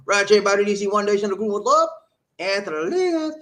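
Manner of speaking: loud, high roll-call holler; hyped shout-out. Real, not acted. Host calling out viewers by name, hyped shout-out. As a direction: belt out the names like a hype man.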